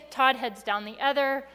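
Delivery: ordinary talk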